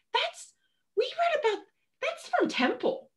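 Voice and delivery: high, slow, puzzled imitation of her partner; partner's voice. Imitating her partner noticing things around Dublin, high and slow. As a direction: squeak it out like a baffled partner spotting clues. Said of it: "he was sort of like".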